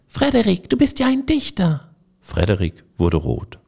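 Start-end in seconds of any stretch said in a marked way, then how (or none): 0.1-1.9 much higher, louder excited squeaks; delighted cheer. A narrator's reading. The mice applaud Frederik the poet, high and delighted. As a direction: cheer him like thrilled little mice. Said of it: "klatschten alle und riefen".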